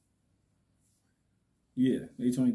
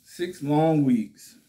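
normal talking voice

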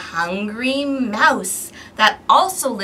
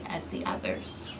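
whispered; 8 semitones lower, 13 dB quieter than usual. Acted. Tiny seed falling behind.

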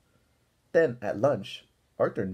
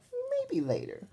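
put-on very high, sing-song old granny; grandma voice; slower than usual. Grandma Thora putting off DW, very high sing-song. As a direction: put her off sweetly as a granny.

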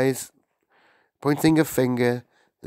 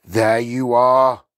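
loud, drawn-out gloating; triumphant cry; 8 dB louder than usual. A narrator's reading. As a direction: crow it slowly and loudly. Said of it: "cried the Trunchbull, triumphant".

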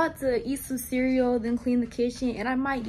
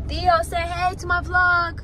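coaxing; 6 semitones higher, 5 dB louder, slower than usual. Real, not acted. Coaxing little brother on camera.

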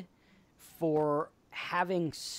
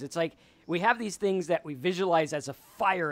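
ordinary delivery

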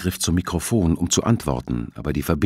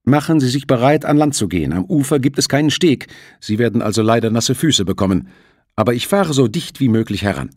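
loud, higher call over the engine; 6 semitones higher, 5 dB louder than usual. Acted. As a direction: call out to the passengers.

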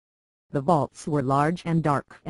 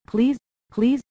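higher, urgent inner plea; pleading prayer; 7 semitones higher than usual. A narrator's reading. Elaine praying to herself not to cry, pleading. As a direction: plead silently with yourself, pitch lifted. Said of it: "she prayed".

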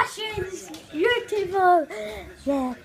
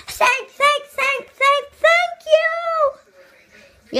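higher repeated thank thank; pitched-up stammering thanks; squeaky thanks; 9 semitones higher, 3 dB louder, much slower than usual. Pretend. Toy stutters thanks to friends, higher voice. Toys give a thank-you speech.

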